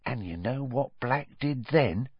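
hushed, suspenseful half-whisper; dramatic whisper. A narrator's reading. Stan holding Harry in suspense, in a dramatic half-whisper. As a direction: lean in for a dramatic reveal. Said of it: "continued in a dramatic whisper".

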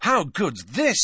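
high, eager delight; enthusiastic. A narrator's reading. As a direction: burst out with keen enthusiasm. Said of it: "said Harry enthusiastically".

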